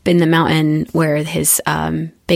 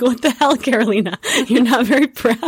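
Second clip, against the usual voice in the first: higher-pitched mock exasperation; mock scolding; 6 semitones higher than usual. Friends in the States challenging her pride, mock scolding. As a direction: lightly imitate friends telling you off.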